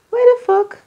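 high, loud exasperated burst; sudden outburst. Real, not acted. Reader exclaims at the card, high and loud. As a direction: blurt it out in disbelief.

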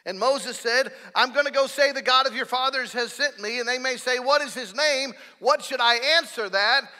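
loud, high impassioned sermon; full-force preaching. Acted. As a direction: preach it hard to the congregation.

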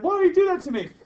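high mock whine, up a lot in pitch; a teacher voicing a student's complaint, playful whine; mock whiny student. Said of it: "She's like".

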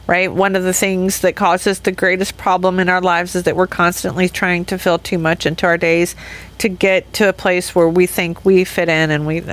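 ordinary talk

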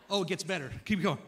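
ordinary talk, nothing marked

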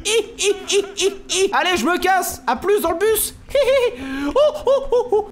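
very high squeals and whoops; panicky squealing. Real, not acted. Gamer making his escape from the monster, squealing panic. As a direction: squeal and yelp through the words as you flee. Squealing while chased in game.

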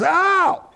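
high-pitched drawn-out "ow" of pain; retold pained yelp. Son poked at fifth stitch, yelping in pain.

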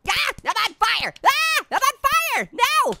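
high-pitched panicked cries; mock panic. Player yelling as the frog catches fire, mock panic. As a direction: cry out in comic alarm.

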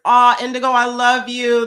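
delighted aw. Real, not acted. Touched by a viewer comment.

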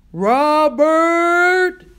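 long, loud, high call across a distance. A narrator's reading. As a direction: holler a name, stretching it out. Father calling from the motel.

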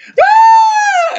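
imitating a man's panicked scream at a helicopter blade; mock scream. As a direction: let out a startled yelp as the blade passes. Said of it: "and he's like".

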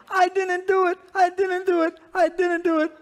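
loud, very high mock child's wail; hammed-up spanked boy protesting his innocence; wailing denial. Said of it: "we all crack under torture after a while".

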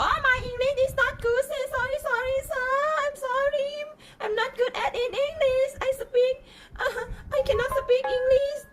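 high, far-up sobbing grovel; mock whiny apology. Mocking Thai staff grovelling to a foreigner in a high whimper. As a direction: beg pathetically, half laughing half crying. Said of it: "ไม่ต้องแบบ".

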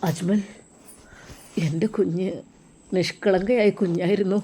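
softly voiced grieving father. Grieving father tells Ajmal about his daughter, softly tearful. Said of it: "Arabi കരഞ്ഞുപോയി".

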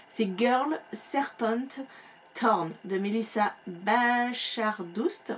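title spelled out slowly after a slip; slow, deliberate correction of a misread title; careful correction. Real, not acted. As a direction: say it slowly to get it right this time. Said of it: "Non, j'ai inversé".

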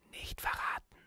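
soft breathy secret-keeping whisper. Acted. Girl whispers to her friend to keep the hidden doll secret. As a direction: hush her friend, barely voiced. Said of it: "flüsterte sie dabei".

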